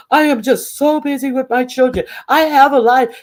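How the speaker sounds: high, put-on busy mom voice; mock self-important. Busy mother mimicked excusing herself to others, mocking.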